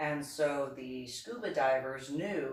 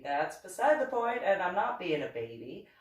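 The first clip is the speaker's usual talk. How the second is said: higher, louder defensive comeback; indignant retold rebuttal; 5 semitones higher, 4 dB louder than usual. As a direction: reject the jibe, voice raised. Retold argument, rejecting baby jibe.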